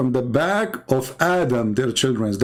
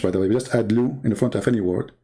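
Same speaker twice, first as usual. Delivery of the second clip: ordinary talk, nothing marked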